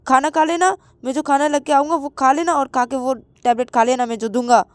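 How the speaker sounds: loud, high, bossy telling-off; scolding shout. Acted. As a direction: order a stubborn patient about sharply. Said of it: "मैंने तुम्हारे ऊपर चि-, चिल्लाया".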